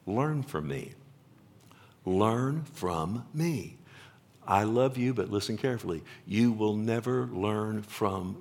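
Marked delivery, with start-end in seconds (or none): 2.0-3.8 very slowly, word by word; slow, deliberate emphasis. Acted. Preacher repeats Jesus' command to the congregation, slow and deliberate. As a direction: spell out the command, one word at a time.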